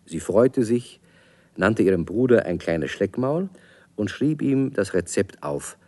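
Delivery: ordinary talk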